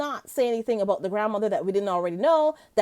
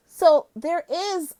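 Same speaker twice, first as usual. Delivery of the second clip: ordinary delivery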